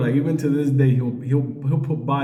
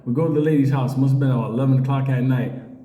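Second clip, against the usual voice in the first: normal talking voice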